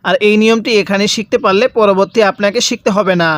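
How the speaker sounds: ordinary talk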